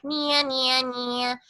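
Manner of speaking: buzzy forward "nya" sounds; nasal middle voice nya. Shown as a demonstration. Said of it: "middle voice is like".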